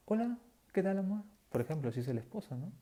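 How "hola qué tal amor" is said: high, sweetly switched-on greeting; suddenly all sweetness. Retold or imitated. Volcano-type wife greeting her husband sweetly after a fight. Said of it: "Y de pronto está".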